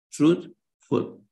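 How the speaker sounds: "Truthful" split into two stressed beats; both syllables stressed. Shown as a demonstration. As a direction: pause between "Truth" and "ful", stressing both. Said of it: "both stress".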